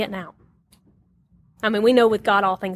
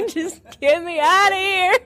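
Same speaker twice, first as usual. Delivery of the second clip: laughing plea; 9 semitones higher than usual. Retold or imitated. Voicing husband's silent plea.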